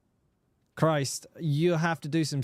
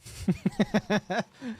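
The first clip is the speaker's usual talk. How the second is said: higher, bubbling chuckle; real laugh; 4 semitones higher than usual. Real, not acted. Streamer joking about getting arthritis from watching, amused chuckle. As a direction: chuckle in short pulses.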